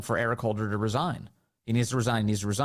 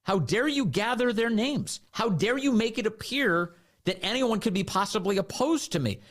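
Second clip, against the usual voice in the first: higher, slow, outraged rebuke; mock indignant tirade; 8 semitones higher than usual. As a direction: jab each how dare you like a finger in the face. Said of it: "gets in his face and he said".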